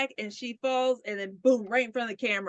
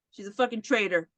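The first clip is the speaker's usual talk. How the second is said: ordinary talk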